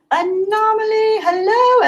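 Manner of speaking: high, slow drawn-out name; sing-song greeting. Acted. Greeting a viewer playfully.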